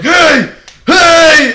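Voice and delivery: very loud, high calling out; angry-owner shout. Retold or imitated. Imitating the owner running out at them, loud shout. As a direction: holler it twice at someone down the road.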